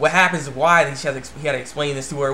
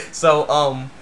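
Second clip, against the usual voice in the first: ordinary talk, nothing marked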